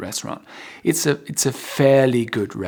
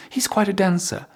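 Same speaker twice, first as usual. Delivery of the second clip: impressed exclamation; 7 semitones higher than usual. Shown as a demonstration. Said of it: "you put emphasis on this".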